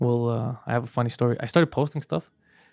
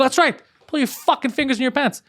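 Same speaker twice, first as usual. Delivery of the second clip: retold angry command; 9 semitones higher than usual. Telling the driver who flipped him off what to do, high angry snap. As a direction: put him in his place. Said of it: "I go".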